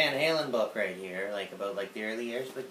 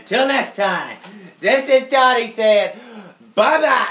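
much higher and louder bye-bye; cutesy sign-off; 8 semitones higher, 13 dB louder than usual. Acted. Signing off to viewers, high loud squeal. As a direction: squeal a cute farewell.

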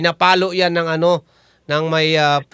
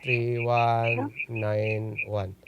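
slow repeat; 10 semitones lower, 11 dB quieter, much slower than usual. Shown as a demonstration. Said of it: "Paulit nga".

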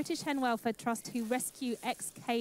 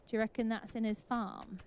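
normal talking voice

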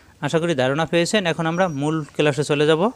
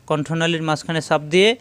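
ordinary delivery

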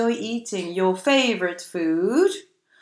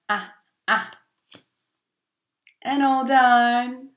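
ordinary talk, nothing marked

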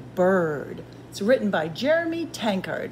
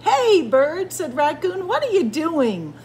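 high, loud hail; lively greeting; 8 semitones higher, 5 dB louder than usual. A narrator's reading. Raccoon hails Bird and asks, lively. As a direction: call out with eager curiosity.

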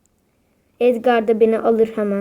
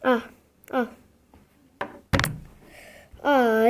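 ordinary talk

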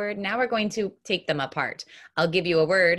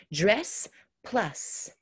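ordinary talk

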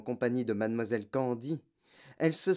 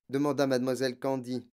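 usual speaking voice

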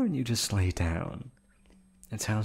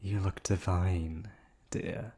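creepy purr; 4 dB quieter, slower than usual. Acted. Creepy admiration of captive.

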